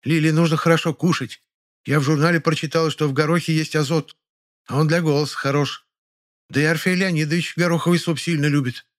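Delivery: high voice, full of importance; self-important housewife. A narrator's reading. Neighbour announces her pea soup, self-important. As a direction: put on a grand, high-pitched matron. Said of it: "важным голосом отвечала ей Полина Харитоновна".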